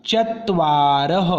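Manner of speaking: चत्वारः drawn out slowly, the final ः as a clear breathy "ह"; visarga pronunciation. Shown as a demonstration. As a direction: say चत्वारः slowly and finish on an audible "ह". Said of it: "तो इसका उच्चारण होगा". (चत्वारः धीरे से, विसर्ग को साफ़ "ह" की तरह)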